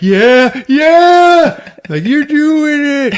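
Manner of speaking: high, loud, drawn-out encouragement; mock excited cheering. Hank behind judges cheering her along, mock excited.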